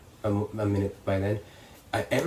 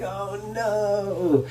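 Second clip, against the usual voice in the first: high, drawn-out comic wail; mock dismay; 10 semitones higher than usual. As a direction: act out helpless dread as you fall. Dismay at falling again.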